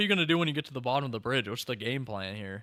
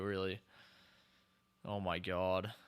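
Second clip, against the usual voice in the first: usual speaking voice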